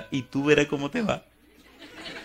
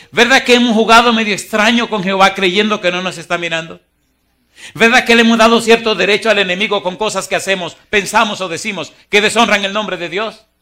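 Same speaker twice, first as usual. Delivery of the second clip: preaching at full force; 7 semitones higher, 10 dB louder than usual. Acted. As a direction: thunder the verse at the congregation.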